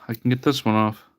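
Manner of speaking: ordinary talk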